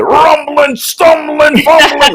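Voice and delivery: booming mock broadcaster rhyme; Chris Berman call. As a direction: belt it like Chris Berman on a highlight. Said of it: "Chris Berman in the back of my head".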